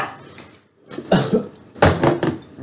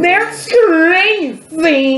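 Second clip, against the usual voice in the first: high drawn-out echo; 12 semitones higher, much slower than usual. Acted. Echoing friend in mock high voice.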